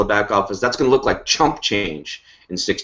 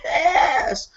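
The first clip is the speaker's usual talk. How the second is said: a much higher, thrilled shout of "Yes!"; imitated excited yes. Host imitating a thrilled teammate, high shouted cheer. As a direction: squeal it like a fan cheering. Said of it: "Sandy's watching this going".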